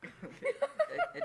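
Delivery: bursting into laughter; high laugh breaking the name. Real, not acted. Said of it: "It looks like a little hat".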